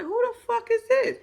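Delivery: high-pitched incredulous question; re-enacted bewildered squeak; baffled reaction. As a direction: voice shoots up in disbelief. Acts out reaction to grinning stranger.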